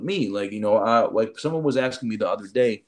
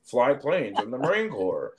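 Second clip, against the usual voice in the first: slow, lifeless, wooden intro; stiff boring recruiter; slower than usual. Retold or imitated. Recruiter mocks a dull class talk, slow and wooden. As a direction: drone it out with no personality at all. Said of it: "stiffer than freaking Stiffy McGee".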